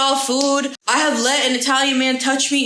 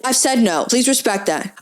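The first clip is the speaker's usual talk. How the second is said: ordinary talk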